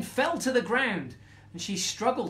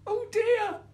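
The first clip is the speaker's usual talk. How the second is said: very high, tiny frightened squeak; squeaking mouse; 4 dB louder than usual. A narrator's reading. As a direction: squeak it out like a scared little mouse. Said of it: "squeaked the mouse".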